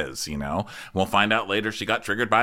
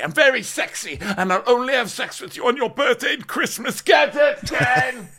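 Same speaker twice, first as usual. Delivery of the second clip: high, hammed-up pushy coaching voice, building to a shout; mock bossy pep talk; 9 semitones higher than usual. Friend coaching her before the meeting, hammed-up high bossy voice. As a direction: egg her on in a shrill put-on voice that ends shouting. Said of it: "So she's like".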